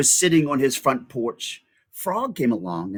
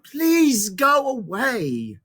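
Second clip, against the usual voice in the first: pleading; 10 semitones higher, much slower than usual. Acted. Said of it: "cried Frog".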